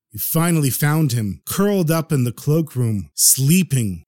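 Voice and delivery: plain reading voice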